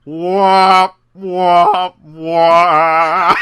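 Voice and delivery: loud, whiny wah wah; mock sad trombone.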